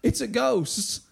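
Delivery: a touch of alarm, slightly lifted; crying out. A narrator's reading. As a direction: read it as a measured cry of alarm. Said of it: "they cried out".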